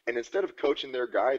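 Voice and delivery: ordinary talk, nothing marked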